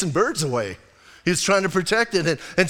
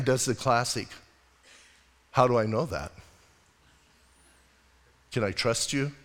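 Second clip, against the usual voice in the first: quiet acted dialogue; 6 semitones lower, 7 dB quieter than usual. God and Abraham dialogue acted.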